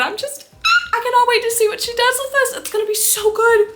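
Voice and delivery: high, loud and fast with excitement; excited gushing. Real, not acted. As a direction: burst out in giddy, squealing anticipation. Gushing about a favourite author's new book.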